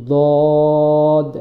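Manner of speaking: long held "দদ" with a heavy, full D; thick dad. Shown as a demonstration. Teacher modelling dad for learners, heavy and drawn out. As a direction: say "দদ" thick, tongue sides pressed to upper teeth. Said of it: "মোটা করে বলতে হবে".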